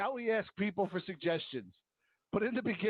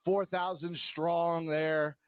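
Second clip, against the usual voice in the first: usual speaking voice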